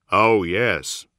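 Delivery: plain reading voice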